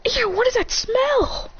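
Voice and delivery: high, slow, disgusted whine; grossed out. Acted. Ninjas smelling the burning bodies, high drawn-out disgust. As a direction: recoil from the stink.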